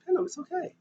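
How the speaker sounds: lightly voiced gentle nudge. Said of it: "He was like".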